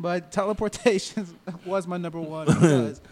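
laughing through the words, slowed; can't stop laughing mid-sentence; after laughing hard. Real, not acted. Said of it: "let me collect myself".

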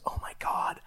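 hushed whisper to a son; retold whisper. A fan leans over to tell his son, whispered. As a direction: whisper it like a secret to your kid.

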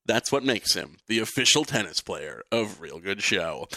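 usual speaking voice

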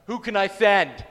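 very loud, commanding call; thunderous acted summons; booming God. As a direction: boom it out like God from the throne. God thundering at timid Isaiah.

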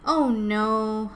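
loud, drawn-out storybook groan; acted groan with a held vowel. A narrator's reading. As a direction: groan it out in dismay. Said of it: "Ava groaned".

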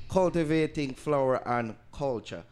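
"Cultivating" punched, slogan cadence; slogan read. Acted. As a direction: deliver it as the brand slogan.

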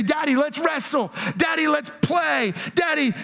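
put-on be the kid pulling dad off the computer; excited kid. Kids begging their father to come play, excited childlike voice.

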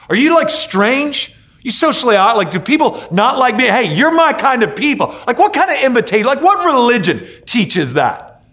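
high, loud, animated preaching; rising excited cry to the crowd; excited outburst. Acted. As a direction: throw it out with full energy. Preacher voicing outcasts and Jesus.

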